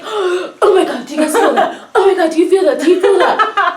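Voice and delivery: high, loud imitated panic; hammed-up frantic alarm; mock freak-out. Imitating a woman at Subway, freaking out. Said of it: "this woman starts freaking out".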